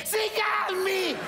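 high, slow, yelling at the patient; doctor yells. Acted. Doctor shouting at the overexcited patient to calm down. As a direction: shout him down, drawing it out. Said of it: "il dottore urla più di te".

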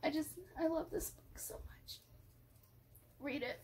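soft gush. Real, not acted. Gushing softly about favorite book.

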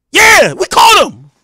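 slow, high, loud hollered confirmation; mock triumphant boast. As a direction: drag out a proud shout, imitating a caller. Caller repeats boast.

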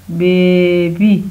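Baby very slow, first syllable held long; stretched-out blending. Shown as a demonstration. Teacher blending the word for pupils, stretched-out word. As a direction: draw out बे, then finish बी.

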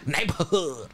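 imitating gangbanger accent; mock L.A. gangbanger accent. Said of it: "They be saying it like that".

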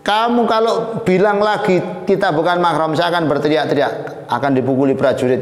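high start, then rushing warning; angry mock threat. Princess warning the young man about soldiers, threatening. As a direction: fire off the threat quickly.

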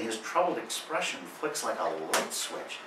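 normal talking voice

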